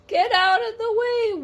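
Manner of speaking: high, loud honking shout; horn shout. A narrator's reading. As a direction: blare it like a horn. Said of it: "The horn is saying".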